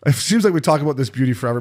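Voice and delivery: normal talking voice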